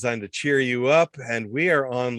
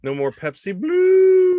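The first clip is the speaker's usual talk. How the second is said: high, loud mock wail; hammed-up crying shout, slow; 8 dB louder, slower than usual. Mock grief over lost soda.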